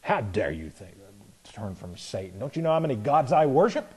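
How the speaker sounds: low, soft scoffing Festus, lightly voiced; mock indignant Festus. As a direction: scoff at the preacher under your breath. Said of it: "He's responding negatively".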